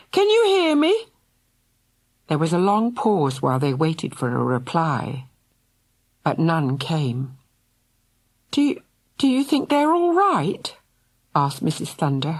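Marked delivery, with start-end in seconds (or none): 0.1-1.1 very high, calling yell. A narrator's reading. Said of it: "yelled Mister Thunder". 8.5-10.8 quavering. A narrator's reading. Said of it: "her voice quavering".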